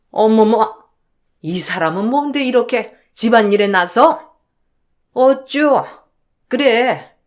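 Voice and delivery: put-on scornful older in-law scolding; indignant, contemptuous matriarch; blustering mother-in-law. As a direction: sneer at the meddler, then storm off. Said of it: "시어머니는 그렇게 호통을 치셨고".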